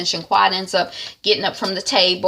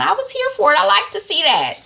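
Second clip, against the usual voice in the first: delighted approval; 11 semitones higher than usual. Real, not acted. Approving the couple.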